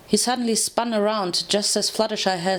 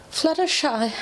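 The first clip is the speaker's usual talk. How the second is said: gasped; 8 semitones higher than usual. A narrator's reading. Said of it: "I gasped".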